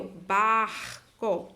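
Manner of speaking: barco very slow, "bar" held before "co"; syllable-final AR. Shown as a demonstration. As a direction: stretch the AR in barco. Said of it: "veja que eu tenho o A R".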